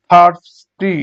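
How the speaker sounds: Thirsty split, first beat loud; syllable by syllable. Shown as a demonstration. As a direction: pause before the final ti of thirsty. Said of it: "syllable অনুযায়ী ভাগ করি".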